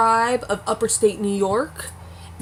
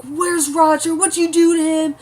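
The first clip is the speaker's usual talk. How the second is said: angry demand; 8 semitones higher, 4 dB louder than usual. Retold or imitated. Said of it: "Brianna storms into Jamie and Claire's little cabin".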